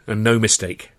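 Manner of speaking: ordinary delivery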